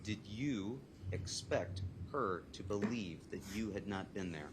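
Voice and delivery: slow, measured courtroom question; drawn-out, carefully spaced questioning; deliberate repeat. Real, not acted. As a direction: repeat the question slowly, word by word. Let me hear the question again.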